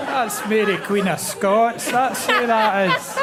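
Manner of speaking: obvious, that's her; laughing, triumphant identification; gleeful recognition. Real, not acted.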